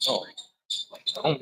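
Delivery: ordinary talk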